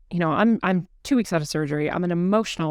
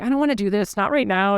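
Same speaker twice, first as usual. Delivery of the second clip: higher-pitched retold protest; mild mock resistance; 4 semitones higher than usual. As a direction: act out a reluctant little plea. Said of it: "I'm resistant".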